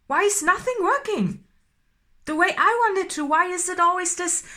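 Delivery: building from loud to high, loud and exasperated; angry outburst. Acted. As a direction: let the frustration climb into a near-shout. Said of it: "I'm getting angry".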